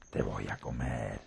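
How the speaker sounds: deep, slow grumpy wolf. Acted. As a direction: growl it out like a cranky beast. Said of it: "dijo el lobo malhumorado".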